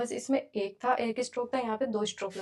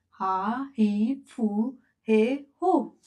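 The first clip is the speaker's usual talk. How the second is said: each syllable drawn out, very slow; slow syllable drill; 5 dB louder, much slower than usual. Shown as a demonstration. Teacher drilling the syllables for students, very slow. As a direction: spell it out slowly for beginners. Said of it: "drilling syllables for learners".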